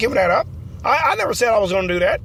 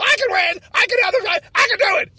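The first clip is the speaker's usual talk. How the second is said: high, rising defiant shouts; fired-up shout; 10 semitones higher than usual. Acted. Candidate defiantly shouting that he can still win. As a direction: shout your defiance, won't back down. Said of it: "calm down, buddy".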